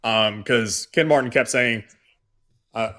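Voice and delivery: usual speaking voice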